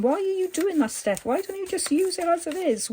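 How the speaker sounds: high-pitched imitation of exasperated viewers; mock screaming viewer. Viewers questioning the crafter's method, mock screaming. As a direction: squeal it out as a pretend protest. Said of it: "you're screaming at me saying".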